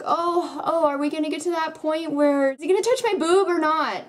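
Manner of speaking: put-on high, breathless lovesick teen voice; mock swooning teen girl. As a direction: gush like a lovestruck teenage girl. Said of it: "it's basically like".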